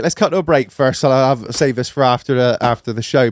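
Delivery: ordinary talk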